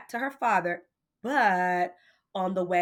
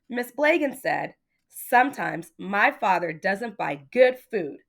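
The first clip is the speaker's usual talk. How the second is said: put-on lively read-aloud for children; story reading; 4 dB louder than usual. A narrator's reading. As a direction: read it like a bedtime picture book. Reading a picture book aloud to children.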